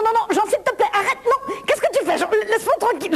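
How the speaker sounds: high, alarmed plea; panicked protest. Acted. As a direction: push him away in a panic. Panicked protest as Jean advances.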